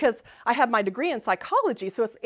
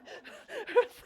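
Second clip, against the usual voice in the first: giggle; 12 semitones higher than usual. Real, not acted. Laughing at her own story.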